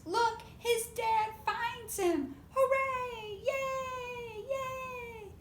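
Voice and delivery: high, loud cheer; high happy hooray. Acted. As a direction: shout for joy, way up high. Monkeys cheer happy ending.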